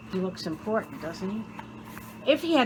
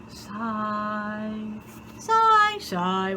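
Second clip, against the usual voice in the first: rock's sighs; much slower than usual. Acted. Narrator voices the bored rock sighing slowly. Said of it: "What do you think a rock would sigh like?".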